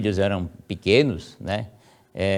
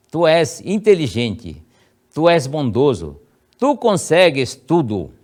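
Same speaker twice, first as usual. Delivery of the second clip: put-on slow, raised, solemn blessing over a child; prayer read aloud; 4 semitones higher, 5 dB louder than usual. Shown as a demonstration. Parent praying over a child at bedside, solemn blessing. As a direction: bless the sleeping son with each phrase. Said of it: "é, tá assim".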